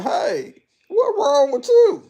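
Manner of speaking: high, loud call. Retold or imitated. Calling the silent slumped lady, high and loud. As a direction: call out to rouse her. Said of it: "And I'm sitting here saying".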